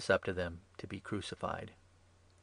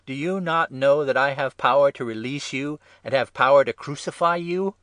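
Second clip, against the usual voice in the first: loud, raised, indignant questioning; forceful challenge; 5 semitones higher, 10 dB louder than usual. A narrator's reading. Pilate asserting his power to Jesus, forceful challenge. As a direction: press a silent prisoner hard.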